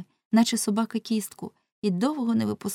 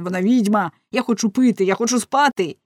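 loud, higher, desperate cries for water and sleep; frantic shout; 3 semitones higher, 6 dB louder than usual. A narrator's reading. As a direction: scream it like a prisoner at his limit. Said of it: "як шалений". (відчайдушний крик)

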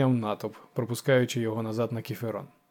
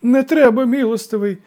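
very high, loud plea; panicked old man's lament; tearful wailing; 10 semitones higher, 9 dB louder than usual. A narrator's reading. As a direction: wail and beg for mercy. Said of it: "заголосив староста".

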